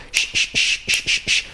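sh, sh, sh, hissed; strong sh. Shown as a demonstration. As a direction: push a strong sh. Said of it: "la S H bien fuerte".

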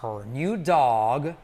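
"Her new dog" stretched out slowly, stress on "new"; slow model phrase. Shown as a demonstration. Teacher drilling learners, slow careful model. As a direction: drag out "Her new dog" for students to copy. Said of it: "pasado, U como O".

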